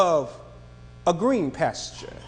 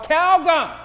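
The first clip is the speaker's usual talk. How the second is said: mock cry of Calgon, pitched up; hollering plea; 7 semitones higher, 3 dB louder than usual. Tired lady in the ad hollering, half-mock. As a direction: call out Calgon like a worn-out lady. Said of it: "she'd just holler out".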